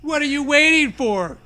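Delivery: high, loud playful taunt; teasing. A narrator's reading. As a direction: tease him into going first. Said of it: "Zoe teases".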